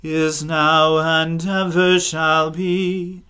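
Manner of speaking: ordinary talk, nothing marked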